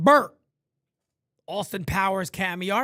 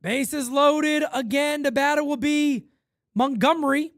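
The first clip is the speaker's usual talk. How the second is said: raised broadcaster call of the at-bat; play-by-play; 10 semitones higher, 5 dB louder than usual. Acted. Streamer calling his baseball game, raised announcer voice.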